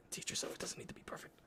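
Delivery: fast, hushed whisper to himself; quick whispered reminder after a small mistake; whispered self-pep-talk. Real, not acted. Self-talk after messing up paint.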